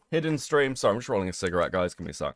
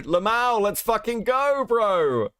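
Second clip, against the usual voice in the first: high, drawn-out hype cry; excited cheer; 9 semitones higher, slower than usual. Real, not acted. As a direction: whoop it up for the chat. Cheers at news in chat.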